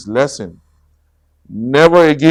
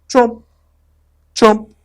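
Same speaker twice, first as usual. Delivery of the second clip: chomp noises; 10 semitones higher, 4 dB louder than usual. A narrator's reading. Scary noises in mist.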